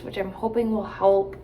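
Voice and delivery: normal talking voice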